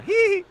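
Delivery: high, loud mock snicker; a sly little titter from the back; imitated giggle. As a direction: giggle like a naughty schoolkid. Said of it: "had a little smirk".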